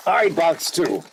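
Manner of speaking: ordinary talk, nothing marked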